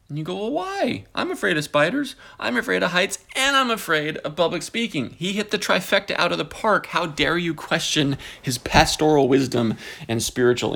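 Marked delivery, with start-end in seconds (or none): 0.4-5.2 mock whiny reply.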